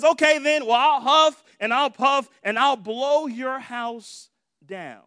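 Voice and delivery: preacher acting the wolf's slow threat; threatening wolf. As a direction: huff out the threat like the big bad wolf. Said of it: "And the wolf says".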